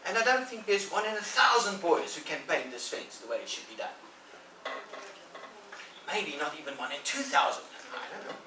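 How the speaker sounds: milk the reluctance, teasing; coy Tom. Acted. Tom praising the fence to hook Ben, coy and grand.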